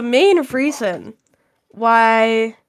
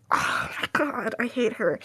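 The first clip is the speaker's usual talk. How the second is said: exasperated; 12 dB quieter than usual. Real, not acted. Fan venting to friends about someone hated, exasperated.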